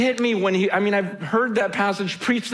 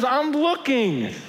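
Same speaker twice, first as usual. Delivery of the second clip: God pleading; 6 semitones higher than usual. Acted. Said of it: "He goes".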